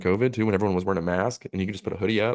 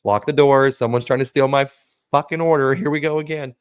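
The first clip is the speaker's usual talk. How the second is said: mock panicked yell; 5 semitones higher, 6 dB louder than usual. Panicked worker calling out.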